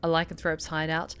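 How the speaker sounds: calm first-person narration read aloud; story narration. A narrator's reading. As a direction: read the game text as a storyteller. Reads visual novel narration.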